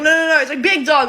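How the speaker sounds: loud, high, protesting re-enactment; hasty backtracking. Retold or imitated. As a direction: hurriedly insist it was a compliment. Hastily backtracking with the teacher.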